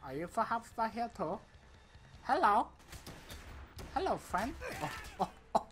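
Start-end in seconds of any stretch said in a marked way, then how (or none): 2.2-5.7 high, cheery put-on soldier voice; silly Mason voice. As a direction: greet the enemy in a goofy high voice. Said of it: "What if Mason talked like that back then?".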